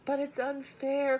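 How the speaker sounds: high, loud outcry; girl crying out to father; cried out. A narrator's reading. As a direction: cry it out in protest. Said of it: "cried Fern".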